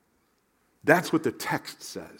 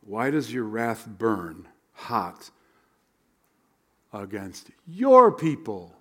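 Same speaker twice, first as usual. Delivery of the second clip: pleading prayer; 5 semitones lower, slower than usual. Retold or imitated. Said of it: "Moses implored the Lord".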